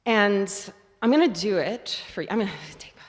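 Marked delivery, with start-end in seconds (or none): 2.8-3.0 whispered aside. Real, not acted. Aside while preparing.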